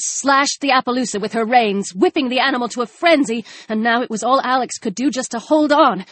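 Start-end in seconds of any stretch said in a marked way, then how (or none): none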